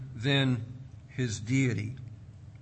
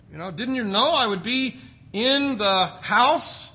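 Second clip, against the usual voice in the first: raised, insistent mock disbelief; incredulous questioning; 4 semitones higher, 4 dB louder than usual. Boy Jesus answering his searching parents, incredulous. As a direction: ask it as if the answer were obvious.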